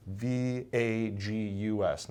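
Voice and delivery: slow, letter-by-letter spelling of V A G U S; spelled out. Shown as a demonstration. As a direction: spell out V A G U S slowly, each letter separate. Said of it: "not like Las Vegas".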